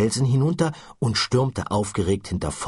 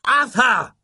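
higher, louder bellow of a name; 6 dB louder than usual. Acted. Ford bellowing at slow-witted Arthur. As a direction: roar the name to cut him off. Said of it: "brüllte Ford ihn an".

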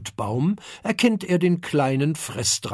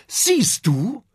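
triumphant call; 8 semitones higher, 3 dB louder than usual. Acted. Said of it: "ruft der kleine Fressdrache".

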